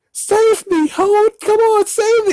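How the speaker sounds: very high falsetto cry for help; squeaky put-on plea for rescue; mock damsel plea. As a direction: imitate a pleading woman in falsetto. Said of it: "where she's like".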